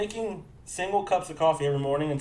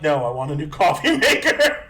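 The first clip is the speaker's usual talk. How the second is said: exasperated retort; 8 dB louder than usual. Retold or imitated. Customer to Geek Squad rep, exasperated mock yell. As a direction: retell it as a fed-up, raised-voice demand. Fed-up retort retold to agent.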